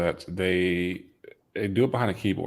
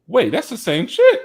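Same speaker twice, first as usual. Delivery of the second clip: high, loud mock astonishment, laughing; laughing retold surprise; 11 dB louder than usual. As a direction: blurt the retold realization through laughter. Laughing retold moment of recognition.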